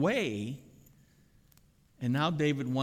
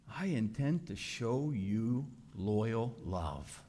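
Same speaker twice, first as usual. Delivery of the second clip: tender promise; 2 semitones lower, 4 dB quieter, slower than usual. Acted. King promising the crippled son loyal love, tender. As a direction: linger on each word tenderly.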